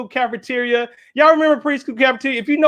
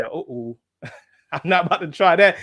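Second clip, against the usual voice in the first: laughing uh-oh; 6 semitones lower than usual. Retold or imitated. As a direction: back away with a nervous laugh. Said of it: "nervous reaction, laugh".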